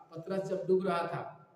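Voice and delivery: normal talking voice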